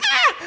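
high squealing scream, half laughing; a jokey high-pitched yelp breaking into a laugh; little girl scream. Retold or imitated. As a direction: shriek like a scared little girl. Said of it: "I've screamed like a little girl sometimes".

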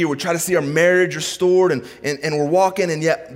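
high, driving sermon delivery; fervent preacher in full flow; impassioned preaching. Acted. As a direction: pile up urgent questions to God.

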